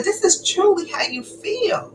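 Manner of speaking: high, hurt and disbelieving; hurt realization. Retold or imitated. Wife realizing how her husband really feels, high hurt disbelief. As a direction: say it like the truth just landed. Confronting husband about the letter.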